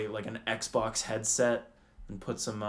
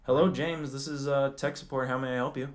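tech support agent. Pretend. Tech support answering a caller, polite phone greeting. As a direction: answer like a courteous help-desk worker. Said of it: "I'm tech support. You're calling me".

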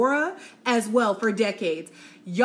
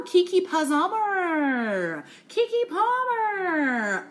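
long, high, drawn-out swoon; gushing name call; 7 semitones higher, much slower than usual. Real, not acted. Vlogger swooning over her favourite star. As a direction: stretch her name out like a fangirl.

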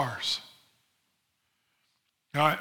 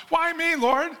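high, slow, whiny protest; a reluctant man whining at God; whining Moses; 10 semitones higher, slower than usual. Acted. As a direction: Moses pleading to get out of the job, voice pitched way up. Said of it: "Moses, quit whining".

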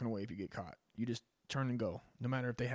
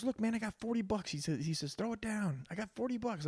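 higher, pleading bargain; a caught thief higher, offering forty bucks; 9 semitones higher than usual. Retold or imitated. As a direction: try to buy off the homeowner. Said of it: "The guy goes".

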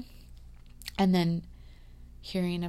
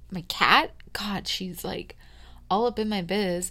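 ordinary talk, nothing marked

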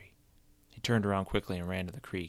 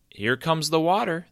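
a louder, higher call; yelled; 9 semitones higher, 7 dB louder than usual. A narrator's reading. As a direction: shout it across the hill. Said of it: "the boy yelled".

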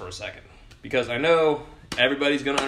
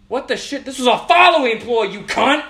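much higher, louder furious yell; furious shout; 9 semitones higher, 7 dB louder than usual. Real, not acted. Yelling at someone who ignored his messages, furious. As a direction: explode at the ghoster. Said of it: "that was that rant session".

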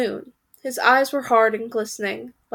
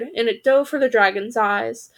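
plain reading voice

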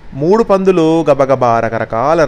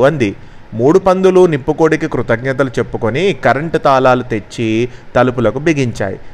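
ordinary delivery, nothing marked

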